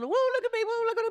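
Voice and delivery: mock the show-offs in a high silly voice. Mocking people who show off.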